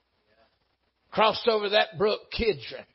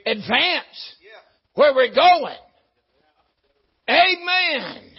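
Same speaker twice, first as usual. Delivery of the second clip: rising, louder preacher's cry building to a shout; full-force preaching; 2 semitones higher, 5 dB louder than usual. Real, not acted. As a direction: lift the congregation, swelling to an Amen.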